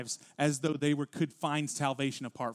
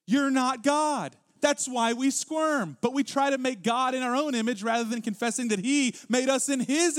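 higher and louder, driving the point home; preaching at full force; 8 semitones higher, 5 dB louder than usual. Acted. Pastor pressing the congregation about needing counsel, fervent preaching. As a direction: preach it hard to make them squirm.

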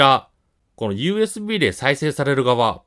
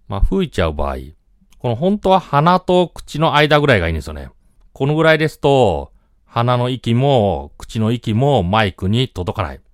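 usual speaking voice

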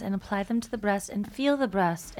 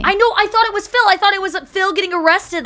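loud, high, laughing admission; excited confession; 9 semitones higher, 6 dB louder than usual. Real, not acted. Friend admitting she believed Phil was arrested, excited and laughing. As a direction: blurt out the mistake through laughter.

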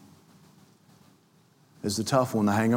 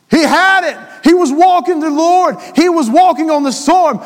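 higher, louder climactic sermon; full-force preaching; 12 semitones higher, 7 dB louder than usual. Acted. Preacher building to the sermon's climax for the church, fervent. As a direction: drive it home at the peak.

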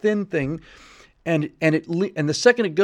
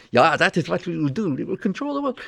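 cartoon villain; 4 semitones higher than usual. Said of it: "this cartoon villain".

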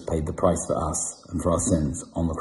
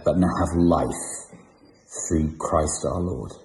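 solemn prayer of confession. Acted. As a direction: pray it humbly before God. Leads a congregational confession prayer.

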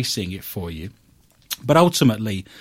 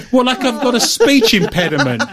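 laughing; 11 semitones higher, 6 dB louder than usual. Real, not acted. Laughing at a teasing remark.

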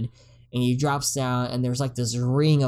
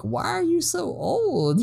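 put-on much higher, slow childlike question; kid voice; slower than usual. Siblings asking old lady Hackmore, high childlike. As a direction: ask it like a puzzled little kid. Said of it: "they're just like".

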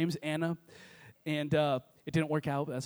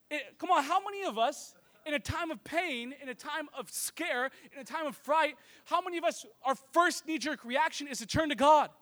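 normal talking voice

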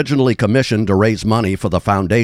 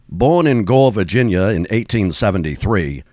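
normal talking voice

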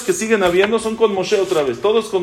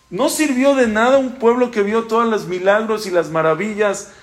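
ordinary talk

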